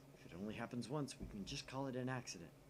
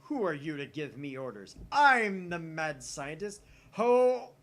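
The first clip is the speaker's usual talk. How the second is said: loud, slow, booming self-proclamation ending in a high shout; mad scientist boast; 6 semitones higher, 16 dB louder, slower than usual. Acted. Defying Kurisu's orders, a loud slow boast with a crowing shout. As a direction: proclaim yourself grandly and crow at the end.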